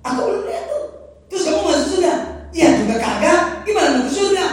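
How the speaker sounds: high, loud teasing questions; voicing God in slang. Preacher voicing God scolding believers, loud teasing questions. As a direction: tease them loudly, half laughing. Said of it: "kata Allah gitu".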